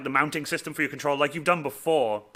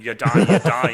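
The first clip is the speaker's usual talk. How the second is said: laughing through the slogan, louder; 7 dB louder than usual. Retold or imitated. Joking about stickers for a friend, laughing. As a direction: chuckle the words out.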